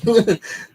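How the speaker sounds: loud high laugh; a quick real laugh. Real, not acted. Laughs before the waiter's line.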